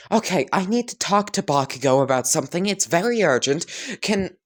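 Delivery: high, fast prim announcement; formal prim girl. Acted. Girl formally tells the mother to leave them alone, prim and high. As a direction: sound very official and proper. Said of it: "as Katsumi would just say".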